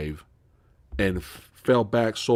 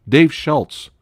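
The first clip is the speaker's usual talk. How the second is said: name blurted higher and louder; excited recall; 6 semitones higher, 7 dB louder than usual. Real, not acted. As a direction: pounce on the name as it comes back. Chat gives the name.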